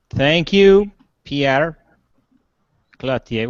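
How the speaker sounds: Pierre Cloutier spaced out, each name on its own; relished name. Real, not acted. Host thanking a regular commenter, relishing the name Pierre Cloutier. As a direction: savour Pierre Cloutier, pausing between the names. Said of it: "which I like saying his name".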